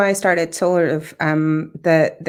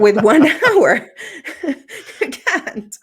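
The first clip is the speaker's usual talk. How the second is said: high, real laughter through the words; laughing; 10 semitones higher, 3 dB louder, slower than usual. Real, not acted. Guest on meditators' crazy days, laughing. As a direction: break up laughing while making the point. Laughs at her own remark.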